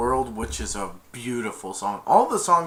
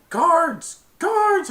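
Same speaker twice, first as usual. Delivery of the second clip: calling the guards; 7 dB louder than usual. Retold or imitated.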